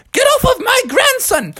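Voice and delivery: very high granny battle cry; high-pitched cartoon grandma to the rescue; fierce grandma. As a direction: order them off in a high old-lady voice. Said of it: "karate chopping every alligator and saying".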